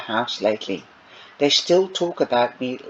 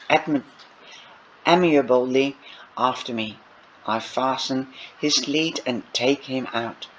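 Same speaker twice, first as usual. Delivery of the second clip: ordinary delivery, nothing marked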